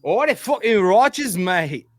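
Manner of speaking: high, slow mock English taunt; imitating thick English accent. Imitating a rowdy English pub local taunting him. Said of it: "he goes".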